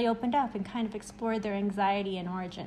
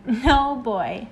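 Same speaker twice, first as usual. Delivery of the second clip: playful scold; 2 semitones higher, 7 dB louder than usual. Acted. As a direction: laugh it off and scold them. Laughing rebuke of overthinking.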